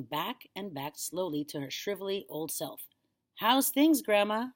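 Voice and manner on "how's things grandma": put-on higher, louder boy asking; lightly voiced boy. A narrator's reading. Boy checking on his silent grandma, higher and louder. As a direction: chirp it up as a light question. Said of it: "George said".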